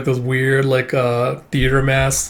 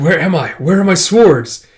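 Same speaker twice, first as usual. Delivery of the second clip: alarmed waking; 5 semitones higher, 6 dB louder than usual. Acted. Wounded man wakes in alarm.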